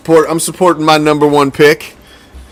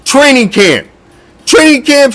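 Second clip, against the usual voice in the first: exasperated rant; 10 semitones higher than usual. Real, not acted. Ranting at ex-Raider.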